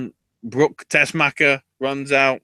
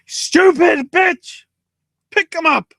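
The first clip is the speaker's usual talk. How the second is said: mock furious yell; 6 dB louder, slower than usual. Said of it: "and Maria goes".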